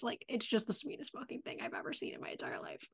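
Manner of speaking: breathless hushed whisper, rushed; awed whisper. Real, not acted. Podcaster whispering to her cohost about the scene, fast awed whisper. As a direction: gush it under your breath, fast.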